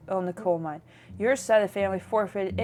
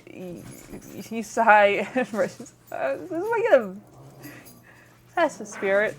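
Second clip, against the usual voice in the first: ordinary delivery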